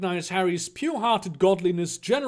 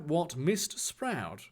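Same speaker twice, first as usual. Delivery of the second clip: plain reading voice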